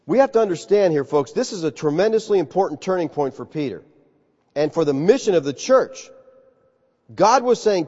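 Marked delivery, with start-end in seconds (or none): none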